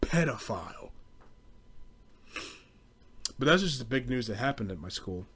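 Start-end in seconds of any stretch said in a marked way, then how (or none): none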